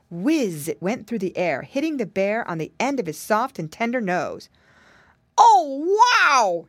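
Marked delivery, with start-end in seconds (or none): 5.4-6.6 loud howl, high then dropping; crying out in pain. A narrator's reading. Bear hit on his tender nose, crying out. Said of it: "he cried".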